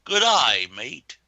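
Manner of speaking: bad mock Australian accent, swooping up on "eye"; exaggerated Australian impression of a friend; imitated Australian accent. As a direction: do a hammy Australian greeting. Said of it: "would say in his Australian accent".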